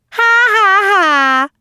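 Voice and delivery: high acted ha-ha-ha; laughing. A narrator's reading. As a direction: burst out in a high, mocking-rabbit laugh. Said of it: "laughed Peter Rabbit".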